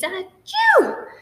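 loud high sneeze. Acted. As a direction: let out a big loud sneeze. Giraffe sneezes.

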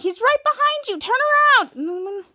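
high, urgent mock warning; acted panicky heads-up to a friend; retold shouting. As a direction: call out high, stretching the last words. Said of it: "while they were shouting at Monsty".

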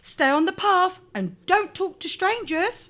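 warning mother. A narrator's reading. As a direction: warn her gently but firmly. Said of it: "warned her mother".

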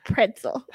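Pretzel as "PRIN-sul", N sounded; own pronunciation. Shown as a demonstration. Woman shows host her way of saying Pretzel, as "prinsel". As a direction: model Pretzel with an N and no T. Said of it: "say it the way I say it".

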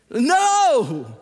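loud, high, drawn-out protest; emphatic outcry. Acted. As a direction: burst out to reject the deadpan act. Rejecting the deadpan Jesus caricature.

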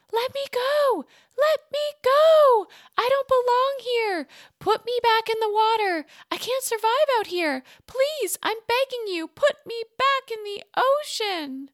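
high, loud begging cry; desperate pleading. A narrator's reading. Captured mer mother begging her captors, desperate and loud. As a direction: plead frantically to be set free.

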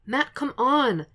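high, slow desperate plea; pleading shout. Acted. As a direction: call out to him, begging. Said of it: "Foggy's voice is a punch".

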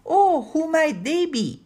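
high, slow, nosy query; a loud, lilting, curious probe; inquisitive housekeeper. A narrator's reading. Missus Cumberstone asks after Dell's grandparents, inquisitive.

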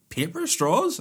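high-pitched, indignant disbelief; incredulous dad. Retold or imitated. Son retells dad reacting to straws, incredulous. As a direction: repeat it back in high disbelief.

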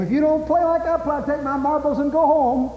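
put-on high whiny kid voice; sulky kid. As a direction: mimic a pouting boy threatening to leave. Little boy in marble game.

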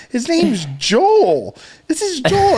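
high, stretched-out correction; slow singsong insistence on the name; group correcting a child. Retold or imitated. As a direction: imitate adults patiently correcting a little kid. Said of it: "we're all going".

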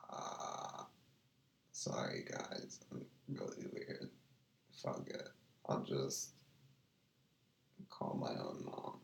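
hushed whisper to the chat; barely voiced, under the breath. Real, not acted. Whispering alone on stream.

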